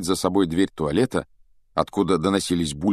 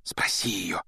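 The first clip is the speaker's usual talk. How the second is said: whispering; 7 dB quieter than usual. Acted. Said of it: "шёпотом попросил Гарри".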